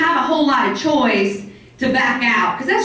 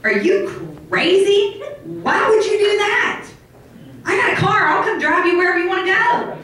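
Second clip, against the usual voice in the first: imitating a baffled friend, much higher; a worried friend pleading, pitched up; incredulous friend; 7 semitones higher than usual. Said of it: "I had some people go".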